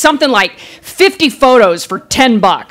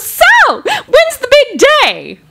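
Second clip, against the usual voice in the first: very high, slow gushing question; imitating an overly cheerful shop clerk; cheerful photographer; 13 semitones higher, slower than usual. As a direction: gush it sweetly, sky-high. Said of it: "she's all cheerful".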